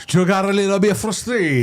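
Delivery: high, hammed-up Italian accent with laughter; mock Italian accent. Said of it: "his Spanish accent became Italian".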